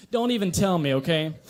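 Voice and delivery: ordinary talk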